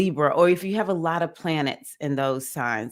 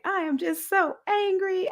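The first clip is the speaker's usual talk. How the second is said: mock rage. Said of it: "I'm like".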